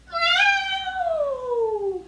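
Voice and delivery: very high, loud cat yowl; a squealing mock cat cry; angry cat meow. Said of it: "your very best angry cat noise".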